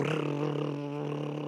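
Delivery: long, steady lip-trilled engine drone; propeller buzz. Retold or imitated. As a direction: imitate a small plane's propellers with rolling lips. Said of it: "these little propellers that go".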